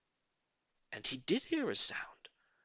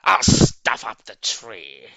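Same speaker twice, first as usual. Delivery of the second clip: gleeful grin; 5 semitones lower, 10 dB louder, slower than usual. A narrator's reading. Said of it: "grinned the Grinch".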